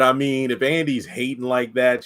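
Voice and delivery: normal talking voice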